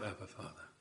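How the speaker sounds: soft, intimate call to a father; hushed tender cry. Acted. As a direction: breathe it like a child to its father.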